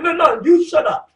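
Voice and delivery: wife mouthing off back to Tobit; talking back. Retold or imitated. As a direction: fire it back at him. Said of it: "Talking shit back to Tobit".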